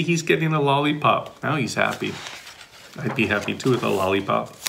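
plain reading voice

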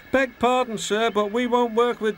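high-pitched, polite refusal; apologetic high character voice; deferential engine voice. A narrator's reading.

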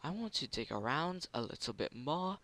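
a hint of a goofy character voice while mining; odd put-on voice. Player to viewers, a light goofy put-on voice. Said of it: "I don't know why I'm speaking like this".

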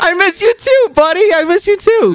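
high-pitched, affectionate cooing; touched. Real, not acted. Answers friend saying he misses him.

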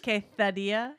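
quesadilla with a lisped th, kay-tha-dee-ya; mock Castilian accent; Castilian Spanish lisp. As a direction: say quesadilla like someone from Spain. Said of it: "Like you're from Spain?".

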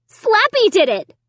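high, loud burst of accusation; blurted out. A narrator's reading. Girl blaming the dummy to parents, blurting out. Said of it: "I blurted out".

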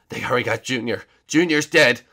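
ordinary talk, nothing marked

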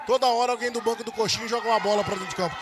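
ordinary delivery

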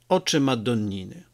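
ordinary delivery, nothing marked

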